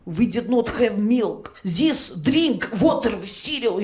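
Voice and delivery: put-on thick Russian accent, firm and emphatic; heavy Russian-accented English; Soviet father. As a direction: immigrant dad laying down the law in a Russian accent. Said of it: "And he'd be like".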